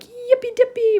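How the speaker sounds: high, loud gleeful yippee; excited squeal. Pretend. Teacher voicing an excited girl, high squeal. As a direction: squeal like a giddy child. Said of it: "she's probably like".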